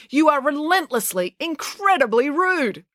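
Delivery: high, emphatic reprimand; indignant teacher scolding a pupil. Acted.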